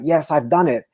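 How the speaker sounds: usual speaking voice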